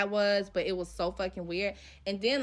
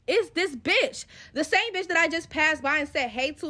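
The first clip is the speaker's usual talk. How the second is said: higher and louder, caught off guard; real shock as it clicks; shocked discovery; 6 semitones higher, 4 dB louder than usual. Real, not acted. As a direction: blurt out the discovery. Discovering the girl's Twitter.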